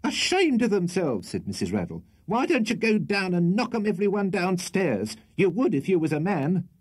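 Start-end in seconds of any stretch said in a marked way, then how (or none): 0.0-1.3 high-pitched, indignant correction; indignant retort. A narrator's reading. Landlady correcting her husband upstairs, indignant. 2.3-6.7 high, shrill nagging challenge; scolding. A narrator's reading. Landlady goading her husband to act, scolding.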